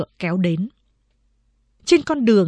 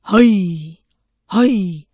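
short, mildly raised "hây" to horses; driver's shout; 4 dB louder, slower than usual. Acted. Carriage driver whipping his horses on, calling out. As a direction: urge the horses on with a brief call. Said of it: "miệng quát".

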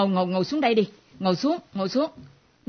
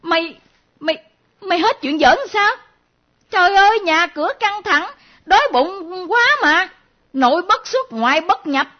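exasperated scolding; 7 semitones higher than usual. Acted. Mother scolds her daughter for joking, high exasperated scolding. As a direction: let the exasperation shoot up high.

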